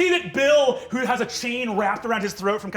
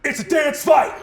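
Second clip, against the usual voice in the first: higher, louder chant; greaser gang shout; 7 semitones higher, 8 dB louder than usual. Acted. Tieflings announcing a dance fight, loud chant. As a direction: shout it together. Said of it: "in unison say".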